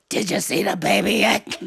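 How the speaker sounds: soprano dropped to bass. Retold or imitated. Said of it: "I thought lower meant quieter".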